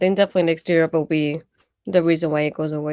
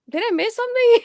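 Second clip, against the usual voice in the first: much higher, louder mock confusion; baffled question; 5 dB louder than usual. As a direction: act like you missed the whole thing. Retold confusion at injury selling.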